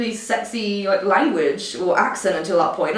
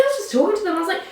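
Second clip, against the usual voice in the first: ordinary talk, nothing marked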